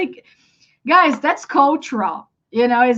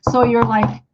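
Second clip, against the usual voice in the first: usual speaking voice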